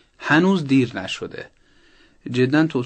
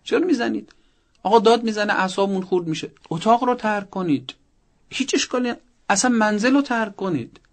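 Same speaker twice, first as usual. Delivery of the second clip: higher, insistent objection; agitated protest; 8 semitones higher than usual. Real, not acted. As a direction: push back hard, caught off guard by it. Expert reacts to parents hitting child. (با هیجان و اعتراض، صدای بالاتر)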